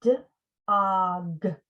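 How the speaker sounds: D-og with d broken off; sound split. Shown as a demonstration. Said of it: "It was dog".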